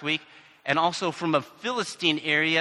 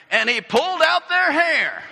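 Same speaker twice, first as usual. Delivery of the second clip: much higher, louder, gleeful punchline; excited reading; 11 semitones higher, 6 dB louder than usual. Acted. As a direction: relish the wild verse. Favorite verse, dramatic reading.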